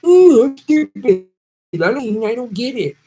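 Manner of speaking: high, slow, dopey mock voice; mock the district's voters as clueless, high and slow; mocking dumb voter. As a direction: play the clueless voter with a sneer. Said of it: "What's it like being dumb".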